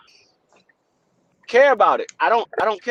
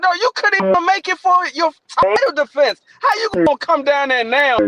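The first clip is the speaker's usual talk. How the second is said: raised pitch, scolding comeback; indignant retort; 7 semitones higher than usual. Acted. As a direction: argue back in high-pitched disbelief. Acts out indignant retort to boast.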